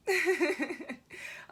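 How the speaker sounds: high, loud real laugh breaking out; burst of laughter. Real, not acted. Laughing hard at her own [name] impression. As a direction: crack up at the bit.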